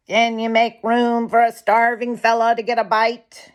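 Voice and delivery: a hungry jay begging a place; meek polite jay. Acted. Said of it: "very meek and most polite".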